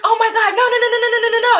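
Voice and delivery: high, rapid string of no's; high-pitched retold protest; overwhelmed refusal. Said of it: "then she really started crying".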